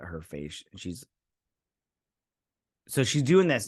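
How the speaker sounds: ordinary talk, nothing marked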